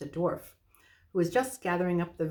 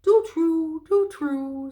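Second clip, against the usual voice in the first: sad owl; 10 semitones higher, 8 dB louder, much slower than usual. Acted. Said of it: "sadly shaking its head".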